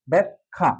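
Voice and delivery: "ব্যাখ্যা" split as byak-kha; correct pronunciation demo. Shown as a demonstration. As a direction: stop on k, then release kha in "ব্যাখ্যা". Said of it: "সঠিক উচ্চারণ". ("ব্যাখ্যা" ভেঙে ব্যাক-খা উচ্চারণ)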